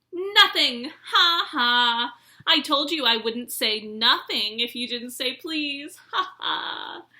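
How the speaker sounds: loud, high triumphant jeer with cackles; gloating cackle. A narrator's reading. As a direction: crow with delight at fooling Filch. Peeves gloats and cackles at Filch.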